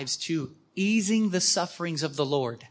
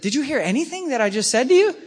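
exasperated disbelief; 7 semitones higher, 8 dB louder, faster than usual. Retold or imitated. Michael exasperated with the sisters.

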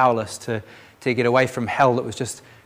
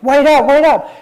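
much higher and louder, shouting across a street; calling out; 13 semitones higher, 10 dB louder than usual. Retold or imitated. Girls off the bus calling after them, loud shout. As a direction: yell after someone walking away.

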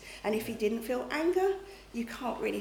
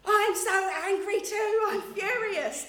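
high, loud, slow voicing of an angry God; God's angry voice; 9 semitones higher, 5 dB louder, slower than usual. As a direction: give God's furious reply, drawn out. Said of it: "I heard God say".

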